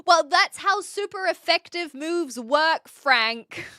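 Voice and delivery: loud, slow, emphatic explaining; playfully indignant lecture; mock exasperation. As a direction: lay it out forcefully for a doubter. Mock-arguing a game point with a viewer.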